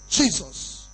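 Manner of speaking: ordinary talk, nothing marked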